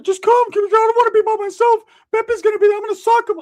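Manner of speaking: squeaky pleading friend, way up high; a shrill-pitched buddy begging you to come; Ricky's voice. Retold or imitated.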